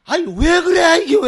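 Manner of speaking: high, loud annoyed outburst; irritated yell. Retold or imitated. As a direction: snap at the knocker at dawn. 경상도 놈 짜증. (짜증 섞인 큰 소리)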